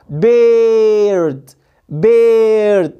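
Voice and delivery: "بيرد" hugely stretched and loud, "beeeyrd"; exaggerated long A. Shown as a demonstration. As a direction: overdo the mistake, drawing out the vowel of "بيرد". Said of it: "قلت لونج ايه".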